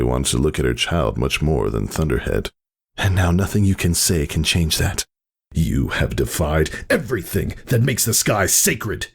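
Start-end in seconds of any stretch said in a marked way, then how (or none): 3.0-5.1 lightly voiced mare standing her ground; firm young mare. A narrator's reading. Whisper to her brother, firm and defiant. 5.5-9.1 raging. A narrator's reading. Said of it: "Thunderhead raged".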